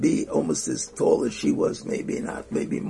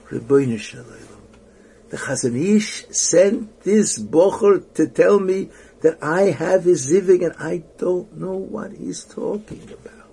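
anguished plea; 4 semitones higher, 7 dB louder than usual. Retold or imitated. Woman pleading at the Kosel, retold anguish. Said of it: "she's standing there crying her heart out".

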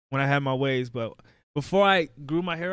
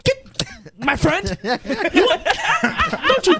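mock scolding parent; 11 semitones higher than usual. Parent scolding the kid at haircut time, high mock yell. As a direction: bark it like a strict parent.

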